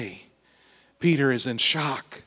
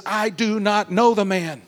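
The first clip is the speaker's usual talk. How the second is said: lost temper; 6 semitones higher than usual. Acted. Peter to the courtyard crowd, exasperated denial. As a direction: snap it out, cornered and fed up. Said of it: "Peter gets so frustrated, he blows his cool".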